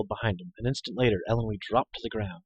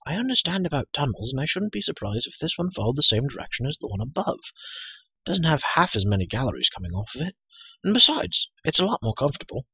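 put-on higher-pitched, chattering girl; brisk self-assured companion, pitched up; Elinor; 5 semitones higher than usual. Girl defending her choice to the hero, high and chatty.

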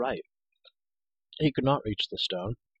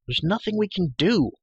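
higher, louder protest; frustrated despair; 10 semitones higher, 5 dB louder than usual. Acted. Trapped hero to the girl, frustrated. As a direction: raise the voice in mild despair.

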